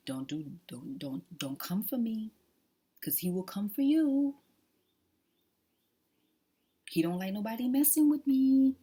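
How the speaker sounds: loud, high warning to anyone who tries her; protective shout. Real, not acted. Woman warning the viewers off, loud and high. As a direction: shout it out, daring them to try.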